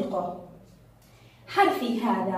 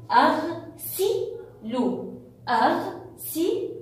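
أغسل in separate, higher-pitched syllables; split into syllables; 4 semitones higher, slower than usual. Shown as a demonstration. Teacher helping pupils find the marked letter, syllable by syllable. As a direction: sound out أغسل with clear gaps. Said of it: "ونريد أيضًا أن نقطعها".

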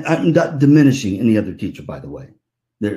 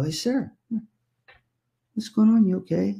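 gentle concern; 9 semitones higher, 3 dB quieter than usual. Retold or imitated. Teacher checking on upset student.

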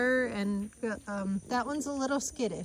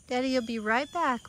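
ordinary talk, nothing marked

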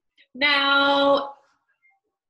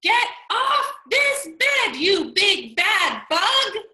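loud, high yell at the bug; 6 semitones higher, 5 dB louder, slower than usual. Acted. Teacher voicing Mac yelling at the bug. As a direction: shout him off the bed.

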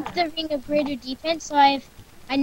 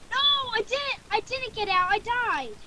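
higher-pitched wail of dismay; dismayed protest; 7 semitones higher than usual. Real, not acted. Player telling friend about dying in game, dismayed. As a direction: protest the loss in a high voice.

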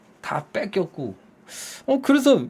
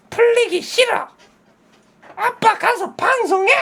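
put-on high childish refusal; sulky kid brushing dad off; 4 dB louder than usual. Daughter refusing to play with dad, high childish sulk.

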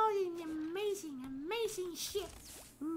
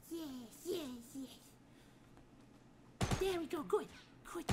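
ordinary talk, nothing marked

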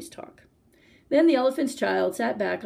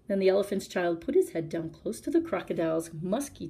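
ordinary delivery

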